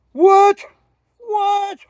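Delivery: very high, loud squealed "What?"; a tourist's stunned high yelp; shocked shout. Retold or imitated. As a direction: shriek it in disbelief, twice. Friend shocked at bought-back weed.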